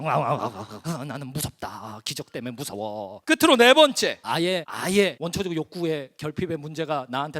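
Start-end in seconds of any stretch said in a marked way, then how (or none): 0.0-3.2 low, soft, teeth-chattering dread; mock trembling fear. Shown as a demonstration. Preacher showing how to tremble before a miracle, mock fearful shudder. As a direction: act out shivering with fright, voice dropped low. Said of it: "어금니를 딱딱 부딪히세요".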